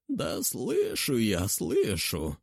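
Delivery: hoarse and slow. A narrator's reading. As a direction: rasp it out hoarsely. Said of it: "услышала хриплое".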